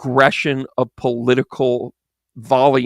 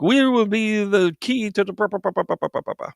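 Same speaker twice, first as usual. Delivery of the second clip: higher French caricature, fading into babble; mock French; 7 semitones higher than usual. The French laying out League rules, in mock French voice. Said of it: "the French were just like".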